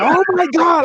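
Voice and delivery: very high, loud astonished cry; floored by the sudden change; mock stunned debtor; stunned oh my God. Debtor stunned on phone call.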